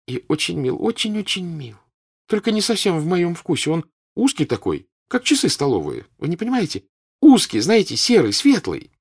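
high, bright chatter; animated girl. Acted. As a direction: daughter eagerly describing a suitor to her mother. Girl describes a suitor.

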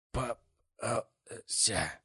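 drawn-out hoarse croak. A narrator's reading. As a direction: croak it like a dying man. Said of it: "прохрипел Неждан".

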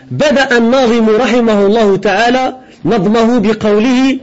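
Arabic commentary reading. Acted. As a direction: read the scholar's text out to the class. Reads the scholar's Arabic commentary aloud. (lecture à voix haute, ton élevé, du commentaire arabe)

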